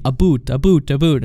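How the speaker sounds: "a boot" with a long oo vowel; mock the Canadian "about" as "a boot". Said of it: "It sounds like you're saying".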